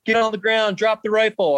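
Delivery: lightly voiced officer giving an urgent order; imitated officer's order. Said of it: "they, like, jump out of the car".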